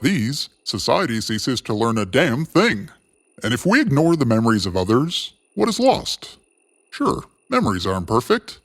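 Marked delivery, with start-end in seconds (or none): none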